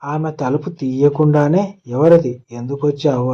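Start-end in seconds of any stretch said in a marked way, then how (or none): none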